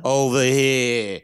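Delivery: put-on drawn-out New York accent; mock New York accent. Co-host ribbing his sober friend, mock New York accent. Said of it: "we both nail the New York".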